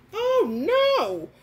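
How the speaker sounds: high, loud mock horror; shocked oh no. Seeing the beetle crawl back, high loud mock horror.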